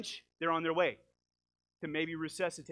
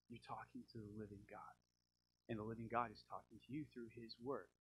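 much softer, lower, hushed sincerity; soft sincere; 6 semitones lower, 12 dB quieter than usual. Real, not acted. Preacher telling listeners God speaks to them, soft and sincere. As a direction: lean in and say it gently. Intimate point about living God.

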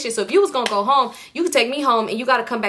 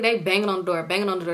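ordinary delivery

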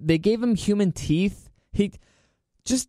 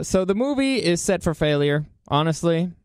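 ordinary talk

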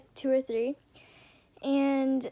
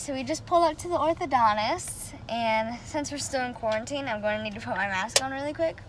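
ordinary talk, nothing marked

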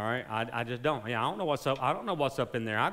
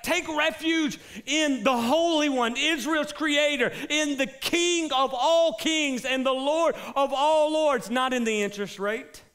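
high, loud, slow preaching cry; full-force preaching; 11 semitones higher, 4 dB louder than usual. Acted. Pastor urging the congregation to trust God, loud slow preaching. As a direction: preach it with fire, slowly.

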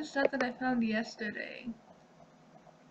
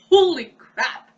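stunned, much louder shout; loud shocked exclamation; 3 semitones higher, 17 dB louder, slower than usual. Real, not acted. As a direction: blurt it out in shock. Shocked reaction.

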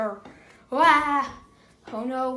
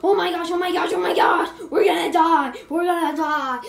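panicked yell; 4 semitones higher, 9 dB louder than usual. Pretend. Pretend monster spotted, panic.

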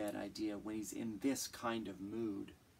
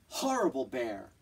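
louder, cross shout while stomping; angry shout; 5 dB louder than usual. Acted. The girl stomping down the mountain, angry shout. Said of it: "The girl stomped down the mountain".

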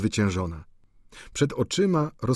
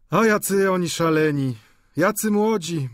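a touch higher, gushing landlady; landlady gushing over the drunk young men; widow Wauquez, lightly voiced; 8 semitones higher, 3 dB louder than usual. A narrator's reading. Landlady fondly clucking at the drunk students.

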